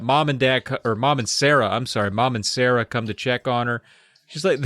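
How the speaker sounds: ordinary talk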